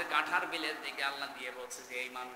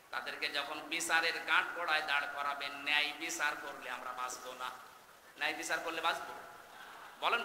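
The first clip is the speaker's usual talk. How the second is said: normal talking voice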